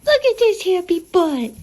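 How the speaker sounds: high cooing delight. Real, not acted. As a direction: coo at the wagging dog. Dog wagging, repeated.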